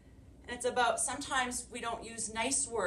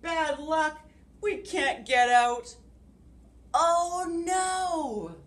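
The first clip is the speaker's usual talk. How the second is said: loud high hammed-up bear complaint; grumbled; 8 semitones higher, 9 dB louder, slower than usual. Sun Bear grumbles after the branch crashes, loud and high. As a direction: grumble big for little listeners. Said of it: "grumbled Sun Bear".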